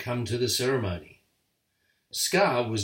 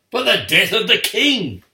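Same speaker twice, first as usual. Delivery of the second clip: much higher and louder triumphant villain; villainous shout; 10 semitones higher, 12 dB louder than usual. A narrator's reading. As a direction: bellow the threat with evil glee. Scar reveals his plot.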